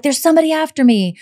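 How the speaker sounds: mock panicked caller; frantic panic. Shown as a demonstration. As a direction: blurt it out like she's terrified. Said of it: "she's not like".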